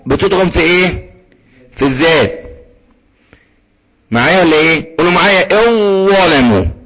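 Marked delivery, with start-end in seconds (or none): 5.5-6.7 held, heavy ظ in الظالم, ذال dropped; merged consonant. Shown as a demonstration. Teacher leads students in repeating, merged consonant. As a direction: say الظالم with the ذال absorbed into a long ظ. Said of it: "الذال مش موجودة، ما تقولش".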